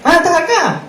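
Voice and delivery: high teasing "ah, you're here?"; mocking high quote. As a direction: squeak it out like a taunting stranger. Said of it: "me dice".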